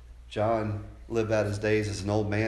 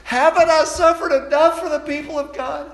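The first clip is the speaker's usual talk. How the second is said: complaining attitude; 10 dB louder than usual. Acted. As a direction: hammer the complaint from the pulpit. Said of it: "that attitude where he says".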